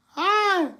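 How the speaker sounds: high, drawn-out cry after laughing; sudden high yelp of reaction; high-pitched exclamation. Real, not acted. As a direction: let out a high "hi" on a held note. Reacting to viewer's jinx joke.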